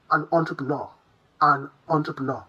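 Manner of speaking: entrepreneur as a flowing on-truh-puh-NAW; soft French-style pronunciation. Shown as a demonstration. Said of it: "a soft flowing pronunciation".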